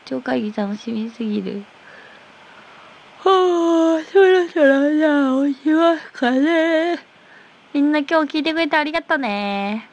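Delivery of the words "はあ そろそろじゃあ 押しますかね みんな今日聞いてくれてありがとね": high, drawn-out stagey voice; put-on high voice. Streamer winding up and thanking listeners, high put-on voice. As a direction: stretch it out in a high silly voice.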